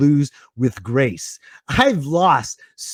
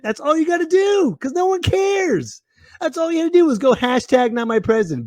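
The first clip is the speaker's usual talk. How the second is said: shouting rant; 11 semitones higher than usual. Real, not acted. Ranting at rioters.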